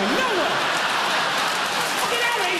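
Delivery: high, incredulous swearing cry; mock-outraged exclamation; exasperated. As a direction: burst out in high-pitched disbelief. Exasperated at dull pet name.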